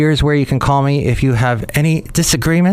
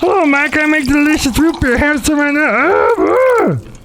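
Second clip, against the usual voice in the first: much higher, wheezy cartoon brewer; brewmaster voice. Brewmaster offers the host root beer in a high cartoon voice. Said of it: "Thank you, Brewmaster".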